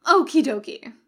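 bright and breezy Okie dokie; cheerful. A narrator's reading. Crowley greeting the Winchesters, breezily cheerful. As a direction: chirp it out cheerfully. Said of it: "Crowley said cheerfully".

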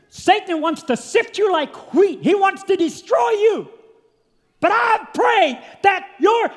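high, loud revival preaching; preaching at full force. Acted. Preacher to congregation about Jesus praying, preaching at full force. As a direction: preach it out to the church, full throat.

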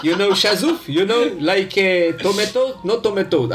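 put-on slow halting English with a French accent; French accent in Hebrew. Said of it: "אז בוא נעשה אותו מבטא צרפתי". (מבטא צרפתי איטי ומגמגם)

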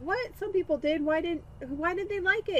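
high incredulous mom; 아들에게 황당해하는 엄마; mock outrage. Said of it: "And I'm like".

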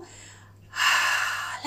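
voiceless breathy ah on the out-breath; audible exhale. Shown as a demonstration. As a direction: breathe out audibly to show the release. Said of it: "e poi dopo".

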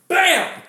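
loud, high "bam" of a blow; sudden impact noise. Retold or imitated. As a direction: burst out with a sudden smack sound. Imitates the sudden hit.

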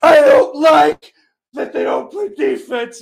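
high, loud, crying mock shout; hammed-up sobbing yell about defense; pretend screaming. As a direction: wail it like a fan falling apart. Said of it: "not to just start screaming".

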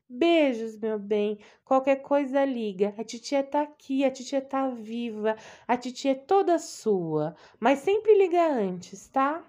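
loud, high-pitched caring aunt; doting aunt. Acted. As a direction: gush over her like an anxious old aunt. Aunt voice again.

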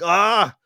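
loud, high mock scream of shock; sudden high-pitched "ah" of pretend alarm; startled cry. Imagined shock at real accent.

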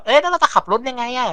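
high, lightly voiced puzzled child; puzzled kid. A kid asking Conan how they'll drive out, in a high puzzled voice. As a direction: ask it like a confused little kid, voice up high. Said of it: "ผู้เด็กมันก็สงสัยว่า".

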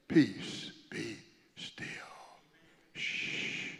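whispered peace be still and shh; hushed calming. Shown as a demonstration. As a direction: lull the storm to sleep. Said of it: "I better not do that again".

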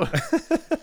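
a real shriek of laughter; high burst of laughter. Real, not acted. Laughing about spaghetti photos.

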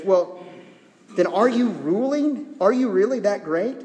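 high, pointed questions; sarcastic challenge. Acted. Paul challenging self-important Corinthians, sarcastic. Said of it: "He's using a".

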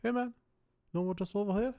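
high laid-back mock Kiwi accent; breezy hammed-up Kiwi accent; mock New Zealander. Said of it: "they're like".

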